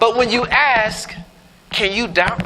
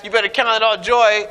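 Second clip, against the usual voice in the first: normal talking voice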